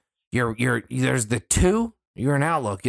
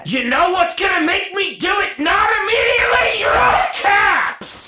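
much higher, loud shout; angry shout; 5 dB louder than usual. Real, not acted. Furious at coworkers who email in caps, shouting. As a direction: yell back, fed up.

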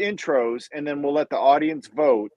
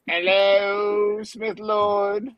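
drawn-out, chant-like bad English accent; 5 semitones higher, much slower than usual. Host hailing Smith Lord in a bad English accent. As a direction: put on a horrible English accent, stretching the vowels. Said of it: "Is that your English accent?".

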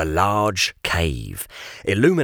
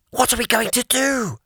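hissed; 13 semitones higher than usual. Acted. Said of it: "Jamie hissed".

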